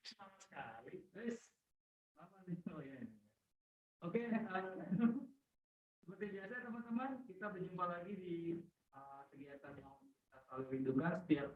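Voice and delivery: faint voice far from mic; fading in and out of range; barely audible. Real, not acted. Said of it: "timbul tenggelam". (suara hampir tak terdengar)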